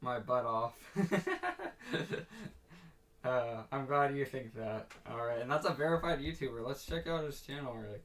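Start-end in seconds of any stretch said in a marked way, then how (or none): 0.8-2.5 laughing. Real, not acted. Laughing after the joke.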